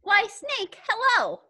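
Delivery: mouse voice. Acted. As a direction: greet them as a perky storybook mouse. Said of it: "such an impressive mouth voice".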